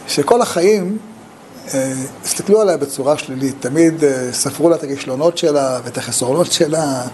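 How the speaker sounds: ordinary talk, nothing marked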